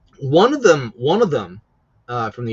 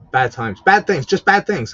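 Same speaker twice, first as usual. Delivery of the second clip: loud emphatic rant; 3 semitones higher, 7 dB louder than usual. Real, not acted. Ranting about the government.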